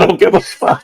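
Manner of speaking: high, loud, laughing repeat; laughing quote. Retold or imitated. Reactor repeats the streamer's blunt line, laughing. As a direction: crack up while quoting him.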